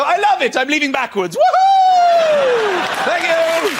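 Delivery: high, whooping joy ending in a shout; thrilled customer leaving backwards; hammed-up glee, rising to a loud woohoo; mock delight. Imagined delighted haircut customer.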